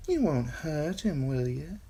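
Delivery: lightly worried Shay; worried young woman. A narrator's reading. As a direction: ask him gently.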